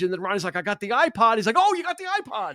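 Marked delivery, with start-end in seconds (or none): 1.6-2.6 imitated thrilled reaction, pitched way up; high, distracted delight, acted out; excited reply. He answers Ronnie about the iPod, excited.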